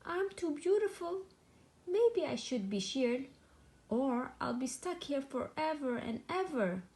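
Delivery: high, a mild frightened sheep wail; a stuck sheep, lightly scared; fearful wail. A narrator's reading. Stuck sheep wailing for help, a touch fearful. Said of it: "baaed with a fearful wail".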